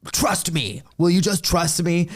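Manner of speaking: pleading George. Retold or imitated. George pleading in retold scene.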